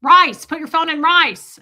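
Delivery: loud, high, slow repeat; imitating shouted advice. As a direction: spell it out loudly for a confused listener. Attorney repeats advice loudly.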